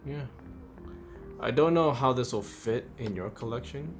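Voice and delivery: ordinary talk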